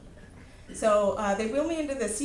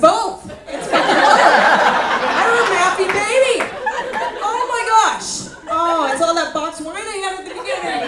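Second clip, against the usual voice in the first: high, very loud hammed-up freak-out; panicked wailing shout; 9 semitones higher, 13 dB louder than usual. Mother told her baby is both, hammed-up panicked wail. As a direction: wail it at full volume, horrified.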